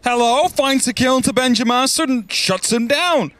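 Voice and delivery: high, rising kill call; excited play-by-play. Real, not acted.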